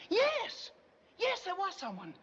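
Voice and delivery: high, emphatic burst of remembering; excited recollection. Acted. Witness telling the detective she saw someone, excited. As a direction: exclaim it as memory suddenly returns.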